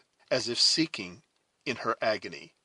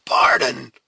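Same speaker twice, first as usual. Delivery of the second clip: loud, explosive angry retort; fierce, angry echo; 3 semitones higher, 15 dB louder than usual. A narrator's reading. Huron captor echoing Cora's plea, fierce anger. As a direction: throw the word back furiously. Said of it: "echoed the fierce Huron, mistaking in his anger".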